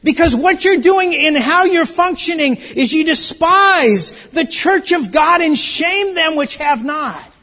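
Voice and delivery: loud, high, slowed preaching; full-force pulpit rebuke; fiery preaching. Acted. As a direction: drive the rebuke home to the congregation.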